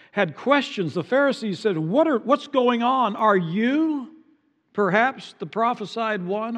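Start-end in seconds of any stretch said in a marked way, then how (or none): 1.8-6.6 excited questioning. Retold or imitated. Pharisees questioning John.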